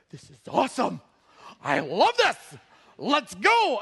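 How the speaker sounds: loud, high excited cheer. Retold or imitated. Preacher imitating a young man fired up to share, excited. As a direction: imitate a thrilled kid bursting out. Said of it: "this young man got excited".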